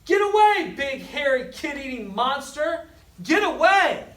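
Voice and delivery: high, loud yelling; shouting. A narrator's reading. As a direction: drive the monster off. Kids shouting at monster.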